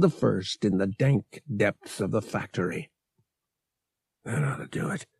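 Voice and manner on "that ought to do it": whispered to himself, barely voiced; a breathy aside to no one. A narrator's reading. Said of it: "Han whispered to himself".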